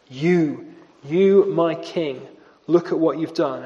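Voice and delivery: "You, you" slow and weighty, stress on "you"; great emphasis on you. Shown as a demonstration. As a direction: lean hard on "you", slowly. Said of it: "with great emphasis".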